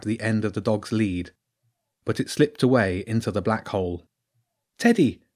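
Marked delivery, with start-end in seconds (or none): 4.8-5.2 high, falling call of alarm; crying out. A narrator's reading. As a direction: call out for the lost dog, pitch raised. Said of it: "Jenny cried".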